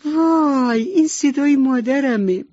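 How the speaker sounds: high, bright little-duckling cry of joy; joyful cry. A narrator's reading. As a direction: squeal with relief at hearing mom. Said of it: "از خوشحالی فریاد کشید".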